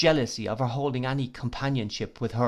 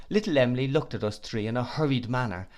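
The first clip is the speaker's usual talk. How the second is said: usual speaking voice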